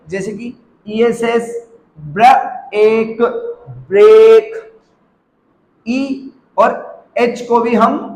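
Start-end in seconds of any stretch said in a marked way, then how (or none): 2.0-4.7 ब्र, then एक, then a stretched ब्रेक; sounded out slowly. Shown as a demonstration. Teacher showing the long ए, sounded out in pieces. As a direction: break ब्रेक into sounds and blend it. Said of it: "ए pronounce करते हैं".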